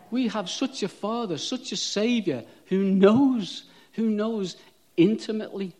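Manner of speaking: rousing preacher declaring God knows; full-force preaching. Real, not acted.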